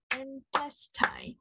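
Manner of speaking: whispered, slow "Intestine", split into three beats: in-tes-tine; clapped syllables. Shown as a demonstration. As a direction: breathe "Intestine" syllable by syllable, very slowly. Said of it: "let's clap three beats for the word intestine".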